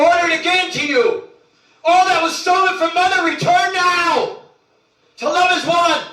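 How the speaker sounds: loud, rising sermon cry; preaching at full force. Acted. Preacher urging listeners to Mama God, full preaching. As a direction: proclaim it to the faithful, building.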